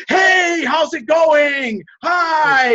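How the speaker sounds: high, loud, drawn-out overeager greeting; shouting hello at a stranger in a bar; overexcited shout. Shown as a demonstration. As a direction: come on way too strong, like you're chasing her down. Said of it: "You don't go up to her and go".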